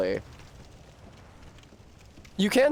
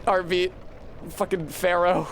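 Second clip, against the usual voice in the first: normal talking voice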